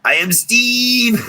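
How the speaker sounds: slow grand declaration. Pretend. Host answering the chat's movie quote, slow and grand. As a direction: proclaim it like a movie line. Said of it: "You are Steve".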